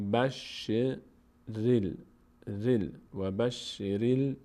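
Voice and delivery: very slow, drawn-out "بشریل"; joined-syllable recitation. Shown as a demonstration. Teacher linking ra to lam, slow syllable-by-syllable recitation. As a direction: join the r to the l slowly, syllable by syllable. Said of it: "ر میچسبه به لام".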